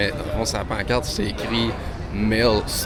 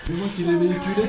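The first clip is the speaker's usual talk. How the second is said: laughing; 9 semitones higher, faster than usual. Real, not acted. Laughing during reunion.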